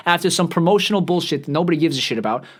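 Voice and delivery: ordinary delivery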